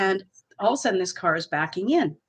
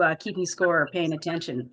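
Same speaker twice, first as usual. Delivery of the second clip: ordinary talk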